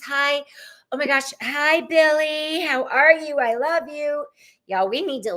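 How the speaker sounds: ordinary delivery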